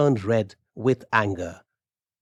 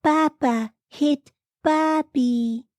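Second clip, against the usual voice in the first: slow, very high little voice; high, drawn-out small voice; Arthur; slower than usual. A narrator's reading. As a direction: speak slowly in a high-pitched character voice. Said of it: "Arthur walked into the room".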